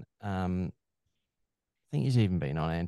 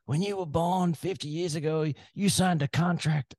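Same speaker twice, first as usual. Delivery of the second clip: higher, hammed-up tough-guy voice; mock Goggins; 9 semitones higher than usual. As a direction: deliver it like a menacing drill sergeant. Quoting Goggins birthday card.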